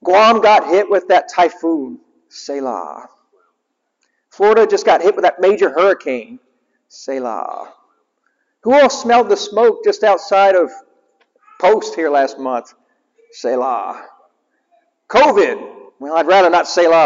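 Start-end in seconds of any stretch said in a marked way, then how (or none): 2.3-3.1 hushed selah. Acted. Repeated pause word. 6.9-7.7 hushed selah. Acted. Repeated pause word. 13.3-14.0 hushed selah. Acted. Repeated pause word.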